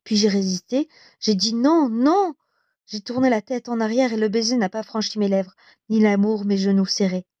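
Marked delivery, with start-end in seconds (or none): 1.5-2.3 higher-pitched, emphatic protest; protesting refusal. A narrator's reading. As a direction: read the refusal with a lifted, insistent voice. Narrator voices her resisting a kiss.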